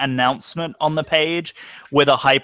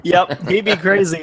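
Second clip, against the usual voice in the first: laughing; 4 semitones higher than usual. Real, not acted. Laughing while joking.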